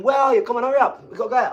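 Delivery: wife calling him away from the skip, impatient; nagging wife. Retold or imitated. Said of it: "my missus went".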